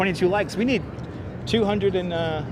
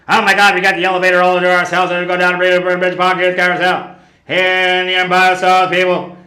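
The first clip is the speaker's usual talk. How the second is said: loud; 6 dB louder than usual. Real, not acted. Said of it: "We gotta yell in the elevator".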